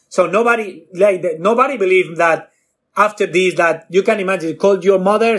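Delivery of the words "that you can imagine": ordinary delivery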